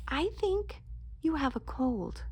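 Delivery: plain reading voice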